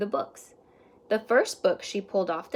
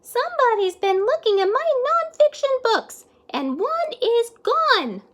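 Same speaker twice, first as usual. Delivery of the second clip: put-on very high, loud little-child voice, slowing at the end; Baby Librarian; 7 dB louder than usual. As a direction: squeak it out like a small upset child. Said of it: "Then Baby Librarian said".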